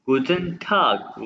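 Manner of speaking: गुटन टाग drawn out very slowly, each syllable clear; slow careful गुटन टाग; slow German demo. Shown as a demonstration. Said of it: "गौर से देखें".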